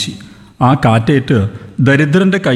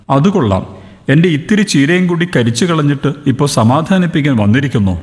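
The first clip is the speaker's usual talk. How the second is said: plain reading voice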